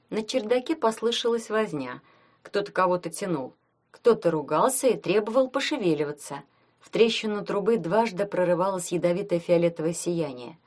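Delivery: ordinary delivery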